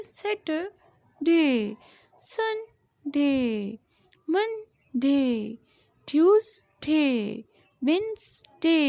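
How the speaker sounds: each day drawn out very slowly; slow classroom modelling; slow model reading. Shown as a demonstration. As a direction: read the days slowly for the class to repeat. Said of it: "teacher models days of week".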